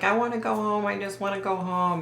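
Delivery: retold small-voiced plea; pleading little boy. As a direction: imitate an upset boy pleading, without crying. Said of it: "the little boy's like".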